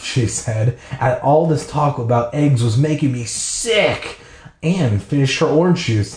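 ordinary delivery

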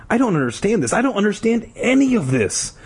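high, frustrated demand; acted bewildered protest. A narrator's reading. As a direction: demand answers, pitch climbing. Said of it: "P D demanded".

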